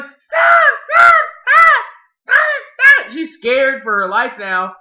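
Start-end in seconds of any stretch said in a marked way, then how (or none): 0.0-3.1 high-pitched loud panicked shriek; mock yelling. Woman yelling at a man beating someone, high mock yell. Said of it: "And she's yelling".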